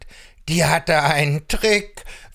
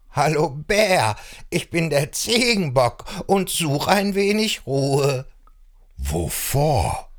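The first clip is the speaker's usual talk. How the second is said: higher, whiny complaining goat; fed-up billy goat; 8 semitones higher than usual. Acted. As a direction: moan about your bleating sisters.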